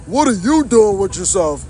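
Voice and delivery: a hint of a smug stranger's voice; lightly voiced nosy stranger; mocking nosy tow guy. Streamer mocks the tow guy, lightly voiced smug question. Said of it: "he hops in the conversation like".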